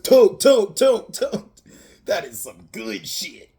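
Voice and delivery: loud, high "tote, tote", then a slow, satisfied "that is some good shit"; a hammed-up stoner killer savoring the hit; stoned Ghostface. Ghostface taking hits from the bong.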